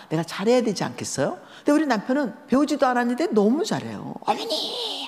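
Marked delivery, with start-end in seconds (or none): 4.2-5.1 high, bright-eyed call to mother-in-law; son-in-law calling out affectionately, high; fond husband call. Retold or imitated. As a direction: sing out to her like a doting son-in-law. Husband greets mother-in-law fondly.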